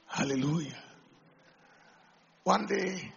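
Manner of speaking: ordinary talk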